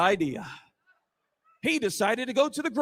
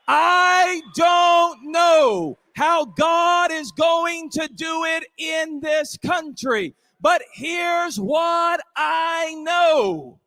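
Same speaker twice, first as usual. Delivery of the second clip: high, slow, drawn-out preacher shout; full-force preaching; 4 semitones higher, 6 dB louder, much slower than usual. Real, not acted. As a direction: stretch each word out to the crowd.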